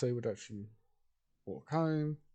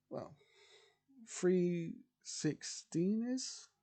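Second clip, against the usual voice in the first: put-on girl's voice; 5 semitones higher than usual. Girl asks stranger for directions.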